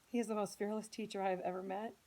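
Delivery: ordinary delivery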